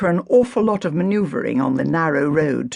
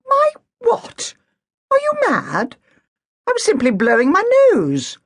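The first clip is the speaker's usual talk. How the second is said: outraged disbelief; 5 dB louder than usual. Acted. Agatha outraged at ticket.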